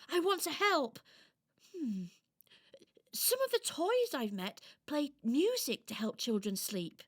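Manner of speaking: high, eager doll voice; helpful little doll. Acted. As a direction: offer help as a little toy.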